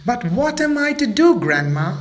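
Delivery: put-on high, childlike plea; pleading little monkey. Mabel asking grandma for help, high and pleading. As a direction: ask grandma helplessly.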